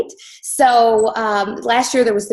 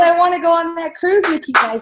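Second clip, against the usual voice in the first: put-on pleading child; 8 semitones higher, 3 dB louder than usual. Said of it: "She said".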